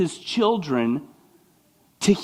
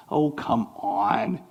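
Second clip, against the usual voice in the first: ordinary talk, nothing marked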